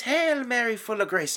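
high, loud nun's recitation; imitating the nun. Nun teaching children the Hail Mary, high mock voice. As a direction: announce it like the nun in class.

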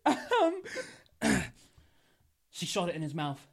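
usual speaking voice

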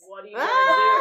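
high, loud confrontational shout; hammed-up shouted taunt; shouted challenge. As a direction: throw it out as a high-pitched yell. Repeated challenge, at full volume.

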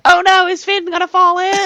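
high, hammed-up worried squeal; mock panic. Friend mocking the movie scene, high mock-panicked cry. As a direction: fake alarm for the kid on the rope. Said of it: "And you're like".

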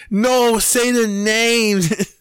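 high, drawn-out mock demand; mad. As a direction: stretch it into an angry, high-pitched shout. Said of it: "He got fucking mad".

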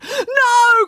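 high, loud, drawn-out scream; horrified cry. A narrator's reading. Kids see dart gun aimed at dog, horrified cry. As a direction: cry out to stop him. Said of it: "cried April".

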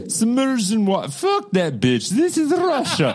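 bad Russian accent, breaking into laughs; mock Russian accent. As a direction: keep the hammy Russian accent going. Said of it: "I can't do a Russian accent".

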